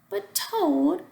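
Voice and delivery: high, loud plea; pleading cry. A narrator's reading. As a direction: cry out to stop him going. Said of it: "cried Frog".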